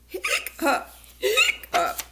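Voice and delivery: two sharp hiccup sounds. Acted. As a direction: hiccup it out like the monster. Monster hiccups, acted out.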